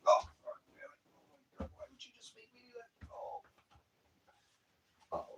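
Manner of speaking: breaking down into short sobbing gasps; real crying that stops the words; real broken sobs. Real, not acted. Grieving mother breaking down on camera, real sobs. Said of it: "Yeah, I'm emotional".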